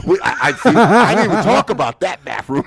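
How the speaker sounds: laughing hard while protesting; laughing loudly. Real, not acted. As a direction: blurt it out through big laughs. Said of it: "I don't give a shit".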